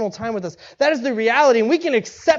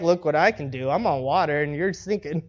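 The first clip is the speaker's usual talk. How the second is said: low, softer gloating; mock boasting; 7 semitones lower, 5 dB quieter than usual. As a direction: lightly play a smug show-off. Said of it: "He's not boasting".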